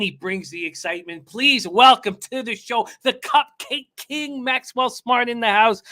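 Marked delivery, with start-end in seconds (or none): 1.3-5.8 high, loud ring-announcer build; hype introduction. Acted. Host bringing the Cupcake King onto the show, loud hype announcer call. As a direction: sell the entrance like a ring announcer. Host hypes guest's entrance.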